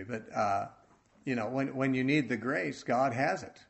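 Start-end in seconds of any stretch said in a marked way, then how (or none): none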